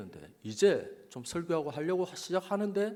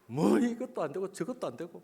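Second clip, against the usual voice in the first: higher-pitched mock grumble; grumbling; 4 semitones higher than usual. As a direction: imitate yourself grumbling and huffing. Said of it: "막 투덜거리고". (투덜거리는 흉내)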